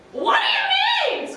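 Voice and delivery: very high, loud, slow squawk; squawking albatross. A narrator's reading. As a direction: squawk it like a seabird. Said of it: "squawks the albatross".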